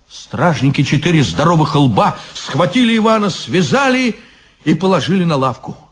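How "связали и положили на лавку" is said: plain reading voice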